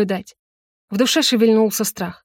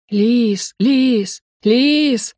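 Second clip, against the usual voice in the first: rising desperate calls; 3 semitones higher, much slower than usual. Acted. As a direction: call after her, building. Calling after her, rising.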